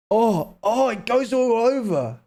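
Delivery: high-pitched real reaction; startled by shivers. Real, not acted. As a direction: exclaim as a chill runs through you. Chill spreading over the body.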